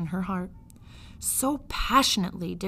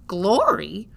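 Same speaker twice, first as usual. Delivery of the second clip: louder, wondering repeat; loud amazed echo; 7 dB louder than usual. Acted. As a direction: shout the word in wonder. Midge repeats the word amazed.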